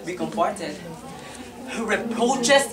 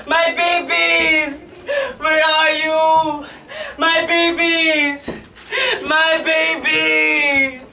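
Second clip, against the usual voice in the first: slow, louder, higher wailing; wailing lament; 6 semitones higher, 6 dB louder, much slower than usual. Acted. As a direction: cry out for the lost babies, drawn out. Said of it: "Her screams and lamentations".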